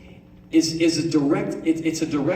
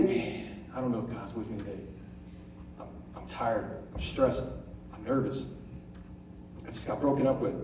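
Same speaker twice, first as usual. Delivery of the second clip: weary inner voice; 7 semitones lower, 9 dB quieter than usual. Retold or imitated. Imagined weary inner voice, dejected.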